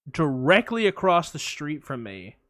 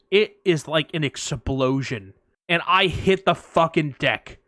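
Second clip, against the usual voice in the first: normal talking voice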